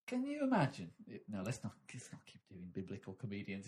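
normal talking voice

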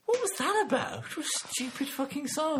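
mock outrage. Real, not acted. Outraged disbelief at song.

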